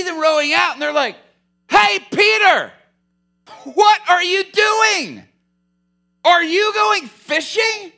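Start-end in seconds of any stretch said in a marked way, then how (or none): 1.7-7.9 loud, high, drawn-out taunting call; mocking call across water. Shore fishermen jeering at Peter from the beach, loud slow holler. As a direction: holler slowly across the lake to mock him.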